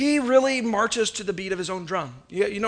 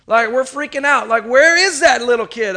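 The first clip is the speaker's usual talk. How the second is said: louder, higher retold panic; mock-frantic parent looking for a lost kid; 3 semitones higher, 8 dB louder than usual. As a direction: relive the fear of losing him. Panicked parent searching for son.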